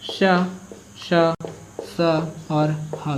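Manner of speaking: श, स and ह drawn out as hissed friction; fricative demo. Shown as a demonstration. Teacher demonstrates the fricatives श स ह to the class. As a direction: let the air hiss through श and स, breathe out the ह. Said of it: "थोड़ा मुझे भी तकलीफ होती है इसको बोलने में".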